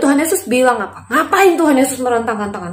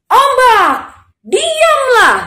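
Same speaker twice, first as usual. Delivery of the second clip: high, loud drawn-out order; ringing shout of authority; commanding; 14 semitones higher, 6 dB louder, much slower than usual. Acted. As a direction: call out a command to the waves. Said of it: "Tuhan Yesus bilang lagi".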